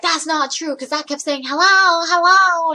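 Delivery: mock indignation climbing to loud, high hellos; indignant caller. Caller insisting to the worker that she spoke, indignant.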